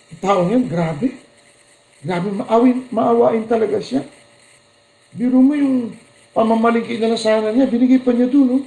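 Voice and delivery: high, admiring gossip voice; gushing bystander. Retold or imitated. Preacher mimicking people praising a generous man, gushing. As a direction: gush over someone's kindness.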